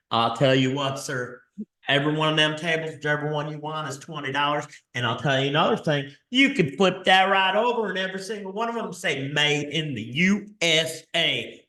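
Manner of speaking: hammed-up Texas sales pitch; Texas accent. Seller pitching twenty-dollar tables to a buyer, in a Texas accent. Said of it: "I do that horrible Texas accent".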